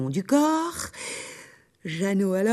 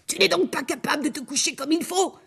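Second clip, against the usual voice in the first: angry; 6 semitones higher, faster than usual. Acted. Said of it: "dit la fille en colère".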